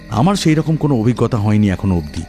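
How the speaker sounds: ordinary delivery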